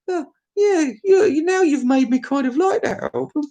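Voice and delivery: high fake sobbing voice; hammed-up blubbing, high; mock crying. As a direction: whimper it like a crybaby.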